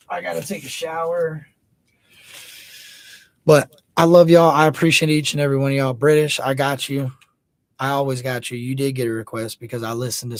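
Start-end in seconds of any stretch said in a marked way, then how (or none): 3.4-5.9 loud shoutout. Real, not acted. Loud sign-off shoutout to chat.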